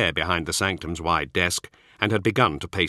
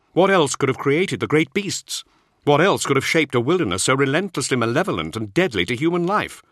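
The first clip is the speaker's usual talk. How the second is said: raised, intense Space Marine voice, higher; a warrior insisting to his lord, higher and louder; Zahariel's voice; 7 semitones higher, 4 dB louder than usual. Acted. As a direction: press the point with urgent conviction. Zahariel argues before Grandmaster.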